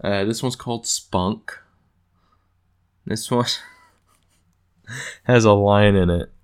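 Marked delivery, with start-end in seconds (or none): 4.2-6.3 laughing into the words; louder, amused and cracking up; real laugh. Real, not acted. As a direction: burst out laughing, then talk through it. Cracks up recalling the line.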